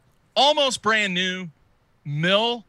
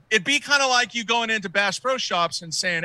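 normal talking voice

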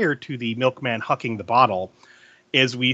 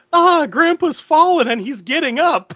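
high, loud mock little-girl cry; 13 semitones higher, 6 dB louder than usual. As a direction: imitate an alarmed little girl in a TV ad. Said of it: "There's this little girl. She goes".

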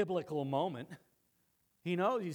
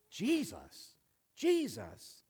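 high-starting, falling exclamation of the name; stunned disbelief; 5 semitones higher, much slower than usual. Acted. Saul trying to figure out the name, stunned. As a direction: repeat the name as if baffled. Saul's brain frying, figuring out.

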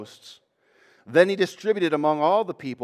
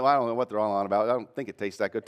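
low, fast, dismissive mumble of a doubter; mock skeptic; 6 semitones lower than usual. Preacher mimicking a critic who shrugs. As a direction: brush it off like an unimpressed onlooker.

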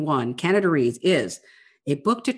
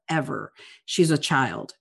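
ordinary talk

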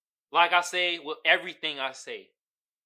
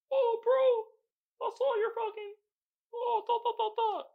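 much higher, sing-song mock fan voice. Vlogger imitating pandering fans who greet him, high sing-song mock voice. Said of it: "That shit be pandering to me".

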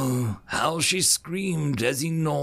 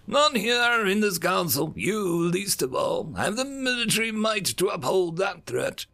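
higher, shouted threat to the council; menacing challenge; 9 semitones higher than usual. Acted. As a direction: loom over them and dare them. Alien envoy threatening the council.

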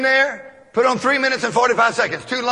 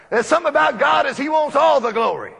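normal talking voice

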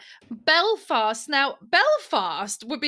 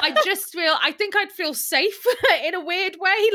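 higher voice with laughter breaking in; amused laughing; 6 semitones higher, 3 dB louder than usual. Real, not acted. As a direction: laugh through an awkward admission. Laughing at her own admission.

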